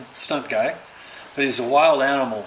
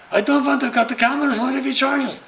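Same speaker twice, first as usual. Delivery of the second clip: higher, pleading voice of a nervous cameraman; mock nervous cameraman, pitched up; fretful cameraman; 12 semitones higher, 5 dB louder than usual. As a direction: whine like a scared crew guy. Cameraman resisting order, retold.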